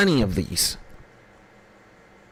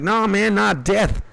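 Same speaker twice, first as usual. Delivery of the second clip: higher comic voice correcting his friend; Cat Williams; 7 semitones higher, slower than usual. Retold or imitated. Comic corrects his worried friend, higher playful voice. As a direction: play it like a comedian setting up the punchline. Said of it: "And he's like".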